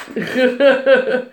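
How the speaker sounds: rapid rippling chuckle; real giggle. Real, not acted. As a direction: giggle at your own remark. Amused at her own remark.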